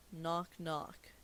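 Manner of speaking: normal talking voice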